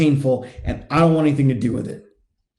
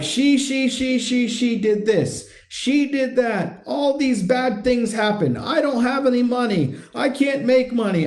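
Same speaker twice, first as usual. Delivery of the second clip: higher, droning whine of a victim; mock victim complaining; 6 semitones higher than usual. Coach mimicking guys who blame their wives, mock complaining. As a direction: run through the grievances in a higher, put-on voice. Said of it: "what I call victim energy".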